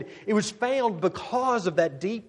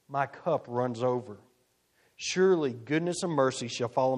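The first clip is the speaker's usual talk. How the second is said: scripture reading; 4 semitones lower than usual. Acted. Preacher reading Psalm 23 to the congregation, solemn and measured. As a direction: read it reverently, letting each line land.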